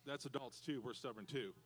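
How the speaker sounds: normal talking voice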